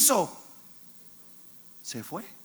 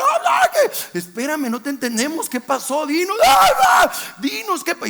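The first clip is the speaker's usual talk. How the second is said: mock frantic pleading; 8 semitones higher, 7 dB louder than usual. Family begging the screaming aunt.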